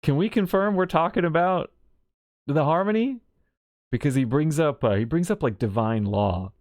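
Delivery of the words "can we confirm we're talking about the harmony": higher, pointed, pedantic query; pointed question. Retold or imitated. Welt presses Sunday to confirm the harmony, pointedly higher. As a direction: push your glasses and ask pointedly. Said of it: "glasses push".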